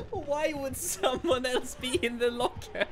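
high, slow, giggling; words squeezed through laughter; laughing while talking. Real, not acted. Laughing at killer's choice.

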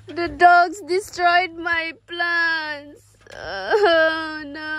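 hammed-up slow wail over ruined plants; mock crying. Wailing to her family over dog-wrecked plants, mock crying.

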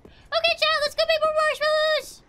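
very high, put-on cheery voice; sugary high-pitched excitement; gleeful singsong call. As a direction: squeal it with exaggerated glee. Cheery call after the fight.

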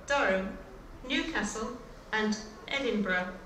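ordinary talk

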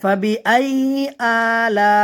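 ফাবিআই আলা with distinct, chanted vowels; clear articulation. Shown as a demonstration. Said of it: "আওয়াজ স্পষ্ট".

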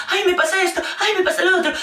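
high, whiny mock complaint; mock pitiful friend. Mocking the friend group's self-pitying complainer, high and whiny. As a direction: whine like the friend who always wants pity. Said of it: "el amigo de un grupo que siempre va dando pena".

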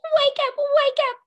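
very high, loud, fast wake-up cry; game whistle sounding to wake the class; shrill whistle-like wake up. Pretend. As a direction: blast it like a whistle. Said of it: "I'm gonna blow my whistle".

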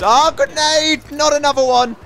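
loud high alarmed yell; grenade warning yell. Real, not acted. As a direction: shout the warning in panic. Grenade lands near player.

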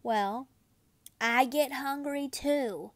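usual speaking voice

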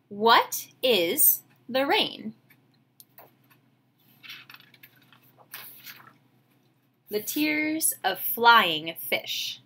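put-on slow, gentle read-aloud for kids; storybook reading. A narrator's reading. Teacher reading a picture book to her class, slow gentle narration. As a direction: savor each line like a bedtime story.

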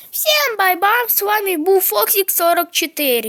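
high loud cheery sign-off; outro announcer. Real, not acted. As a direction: belt out the channel sign-off.

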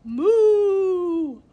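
loud, long high moo; a cow bellowing. A narrator's reading.

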